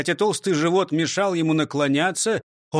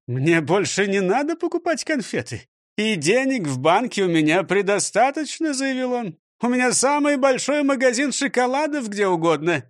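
lightly voiced proud shop owner; pompous director; 6 semitones higher than usual. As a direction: puff yourself up. (слегка важный директор)

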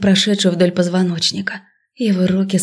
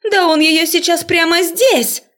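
высокий растянутый вопль ученицы; завопи потрясённо и высоко; 10 semitones higher than usual. A narrator's reading. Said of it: "Обрывок чьего-то вопля". (протяжный возбуждённый крик)

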